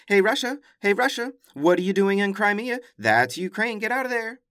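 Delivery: high, loud scolding call; mock outraged shout. The world shouting at Russia to leave Crimea, loud high scolding. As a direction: yell at Russia like an indignant crowd.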